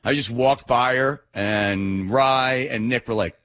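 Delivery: usual speaking voice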